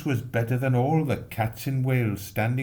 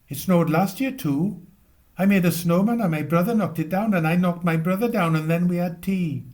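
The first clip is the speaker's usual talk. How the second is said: put-on high, loud, fast child's voice; a small boy butting in with his own snow story; 9 semitones higher, 6 dB louder than usual. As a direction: rattle it off like an eager little boy. Small boy interrupting narrator.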